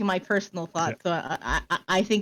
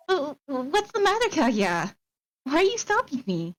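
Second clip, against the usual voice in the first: put-on higher, startled young heroine voice; nervous heroine; 6 semitones higher than usual. Heroine answering her servant at the door, higher and nervous. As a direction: feign innocence as you wave him off.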